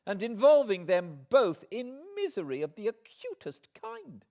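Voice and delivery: high and loud, climbing in fury; an offended woman flinging every grievance at him; indignant outburst. Acted. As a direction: let the indignation build until it bursts. Heroine accusing him of cruelty.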